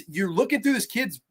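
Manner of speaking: normal talking voice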